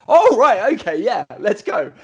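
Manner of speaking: acted high, loud "oh", then eager agreement; sudden realisation. Retelling finally understanding the slang, in mock sudden realisation.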